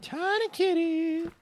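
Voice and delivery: slow, high squeal over a kitten; high-pitched cooing. Real, not acted. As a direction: draw it out high and sweet. Cooing at a tiny kitty.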